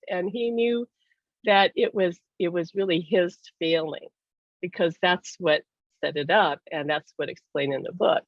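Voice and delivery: normal talking voice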